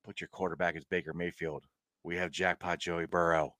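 low, hushed, unbothered retort; deadpan comeback. Retold or imitated. Podcaster answering a ranting rival fan, low quiet deadpan. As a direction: drop right down, quietly smug.

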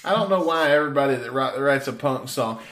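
ordinary delivery

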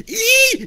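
high, loud wordless squeal; struggling squeal. Acted. Game master voices the struggling old man, shrill loud squeal. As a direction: squeal loudly as if struggling to get free. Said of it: "now he's like going".

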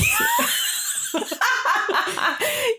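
long, high laughing shriek. Real, not acted. Giving up on saying tourist, long high laugh.

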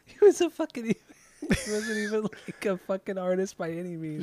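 high, laughing protest. Real, not acted. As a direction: laugh through the words. Laughing protest about Cousteau.

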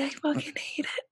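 hushed whisper. Real, not acted. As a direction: whisper it bitterly. Whispered complaint.

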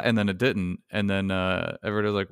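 ordinary delivery

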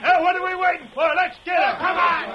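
loud, rousing call to charge; rallying shout. Acted. Troublemaker yelling at the mob to charge, loud and rousing. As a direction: whip the crowd into attacking.

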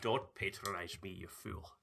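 a hushed hammed-up Viking scolding; mock Viking accent. Viking voice scolds the host, soft hammed-up accent. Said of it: "as Sean Connery".

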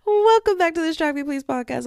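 bright loud announcer welcome; podcast host intro. Real, not acted. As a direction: open the show with energy.

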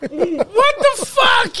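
laughing disbelief. Real, not acted. Disbelief at the story.

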